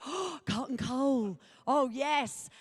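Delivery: ordinary delivery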